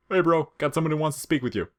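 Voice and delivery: high, loud and fast; Sans's cheery phone call. Acted. Sans tells his brother someone wants to talk, cheerily. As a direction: chirp it into the phone.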